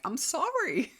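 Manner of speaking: high-pitched, drawn-out mock apology; helpless apology. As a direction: plead it high, half laughing at yourself. Retold helpless apology to embarrassed husband.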